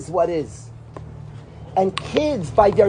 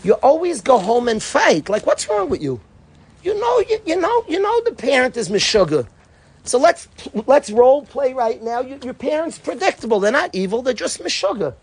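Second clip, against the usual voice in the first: raised, pleading mentor voice; coaching a student; 5 semitones higher than usual. Retold or imitated. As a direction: push the boy to rethink, higher and urgent. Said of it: "I was telling a bacher".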